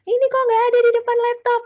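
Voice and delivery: put-on fast, high scolding at the child; mock scolding yell. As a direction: hurry out an exasperated telling-off. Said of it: "langsung teriak".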